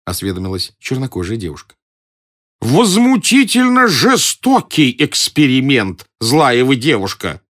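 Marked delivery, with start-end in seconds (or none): 2.6-7.4 high, loud, slow whimpering complaint; whining. A narrator's reading. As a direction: whine like a wronged old professor. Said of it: "захныкал он". (плаксиво, высоко и протяжно)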